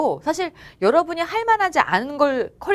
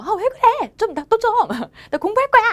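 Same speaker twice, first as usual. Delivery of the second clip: high-pitched petulant complaint, a bit louder; whiny annoyed student; 11 semitones higher, 4 dB louder than usual. Retold or imitated. Student snapping at noisy family at home, high annoyed whine. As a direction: act out a student's irritated whine.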